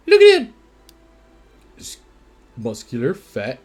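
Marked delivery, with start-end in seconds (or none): 0.1-0.5 much higher, louder delighted cry; excited. Real, not acted. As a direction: burst out with delight. Delighted at the buff character.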